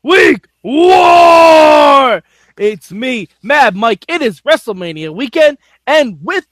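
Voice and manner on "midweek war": long drawn-out announcer shout; announcer yell. Acted. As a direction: stretch it out like a ring announcer. Opening the show intro.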